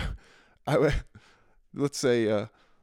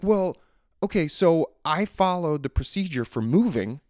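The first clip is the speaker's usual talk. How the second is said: raised, louder, heated complaint to the agent; getting fired up; 7 semitones higher, 5 dB louder than usual. Retold or imitated. Caller getting fired up at a customer service agent, heated and loud. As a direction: push back hard, voice climbing with frustration. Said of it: "getting a little fired up".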